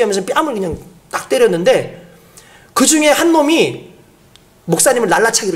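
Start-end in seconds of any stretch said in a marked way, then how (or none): none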